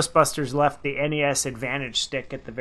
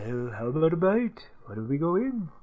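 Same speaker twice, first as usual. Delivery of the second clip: put-on British accent; 4 dB quieter than usual. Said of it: "It's British".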